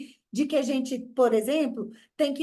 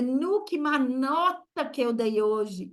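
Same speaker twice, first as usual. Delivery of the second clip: put-on Minas accent; slower than usual.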